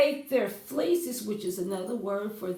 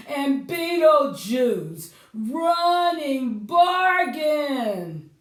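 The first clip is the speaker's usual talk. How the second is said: slow, louder sales-pitch reading; 5 dB louder, slower than usual. Acted. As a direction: sell it like a cheesy advert. Reading a newspaper advertisement.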